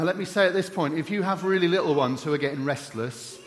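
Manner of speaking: ordinary talk, nothing marked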